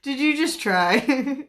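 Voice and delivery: barely gets the words out for laughing. Real, not acted. Laughing at a viewer's try at a snort. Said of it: "I can't".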